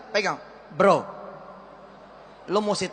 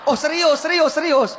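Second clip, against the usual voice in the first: excited; 6 semitones higher, 6 dB louder than usual. Acted. Mock excited reaction.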